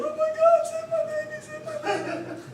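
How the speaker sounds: a teacher playing a desperate mother for laughs; mock crying woman. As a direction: squeal it like a frantic mother, half laughing. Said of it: "a woman crying".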